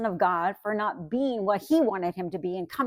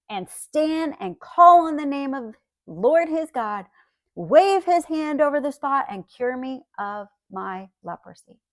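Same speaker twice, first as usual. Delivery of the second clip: raised, higher reading voice for the verse; scripture reading; 3 semitones higher, 4 dB louder than usual. Acted. Pastor reading the Naaman verse to the congregation, heightened reading voice. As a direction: lift the Bible verse out to the room.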